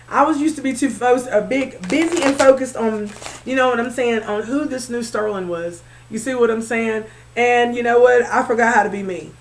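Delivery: usual speaking voice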